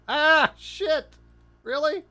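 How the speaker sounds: high, loud exasperated outburst; frustrated surprise. Real, not acted. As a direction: groan at the mishap. Lander tips over.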